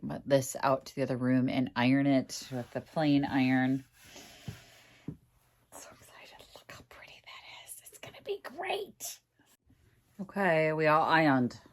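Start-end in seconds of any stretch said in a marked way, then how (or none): 5.1-9.2 soft excited whisper. Real, not acted. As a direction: whisper it like a secret. Hushed delight over paper.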